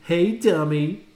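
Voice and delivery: loud, slow playground taunt; taunting call. A narrator's reading. Boy calling out to the girl on the playground, loud mocking jeer. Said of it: "a boy called out to her".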